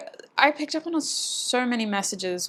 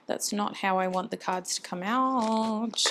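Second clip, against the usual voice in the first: ordinary talk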